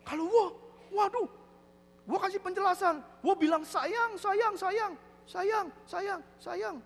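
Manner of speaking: high, pleading acted voice; exasperated husband. Deaf husband explaining to friend his pleas, high exasperation. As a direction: complain high, piling up the endearments.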